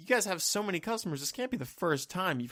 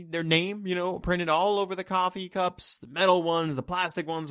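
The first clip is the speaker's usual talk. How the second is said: usual speaking voice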